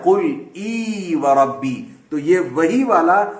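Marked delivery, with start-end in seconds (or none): 0.0-1.9 قل ای و ربی drawn out slowly, recited; slow Quranic recitation. Shown as a demonstration. Teacher recites a Quran phrase slowly to show ای. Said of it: "جیسے قرآن میں بھی آتا ہے".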